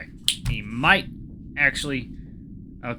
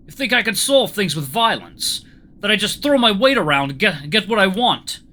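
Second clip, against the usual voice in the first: put-on raised, loud, indignant Russian accent; 8 semitones higher, 7 dB louder than usual. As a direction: challenge them hotly with a Russian accent. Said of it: "see if I can do a Russian accent".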